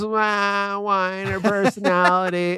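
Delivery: slow, stretched-out whine; hammed-up whiny drawl; mock whiner. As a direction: drag the words out as a mocking whine. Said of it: "weird voice and just this".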